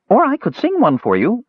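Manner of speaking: high, eager offer; eager kender. Acted. Kender trots after the mage, high eager offer. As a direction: pipe up eagerly.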